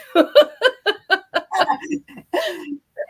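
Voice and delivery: bright rising laugh. Real, not acted. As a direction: laugh it off, amused. Laughs at own accent joke.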